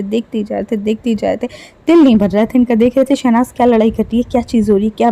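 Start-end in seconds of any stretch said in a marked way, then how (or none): 0.0-1.5 fading tease. Acted. Teasing about Sidharth staring.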